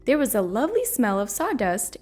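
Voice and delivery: usual speaking voice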